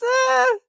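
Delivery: a sharp high yelp of discovery; sudden high cry. Real, not acted. Host spotting a forgotten clip, sudden high yelp. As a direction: yelp as it clicks.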